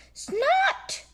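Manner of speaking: high squeaky snort; pig snort. A narrator's reading. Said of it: "says George".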